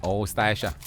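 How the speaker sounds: ordinary talk, nothing marked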